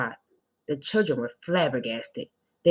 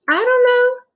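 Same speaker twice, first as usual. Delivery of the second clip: very high, loud bewildered wail; bewildered teacher; 9 dB louder than usual. Acted. Teacher unsure if they are monkeys, very high and loud. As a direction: wail the uncertainty. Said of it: "said Miss Jules".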